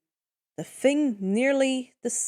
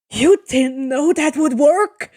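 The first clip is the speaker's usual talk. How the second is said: louder, aghast disbelief; horrified question; 3 semitones higher, 9 dB louder than usual. A narrator's reading. The crew asking Percy after the cave, loud horrified question. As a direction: ask it as if the floor just dropped away. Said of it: "the men ask in horror".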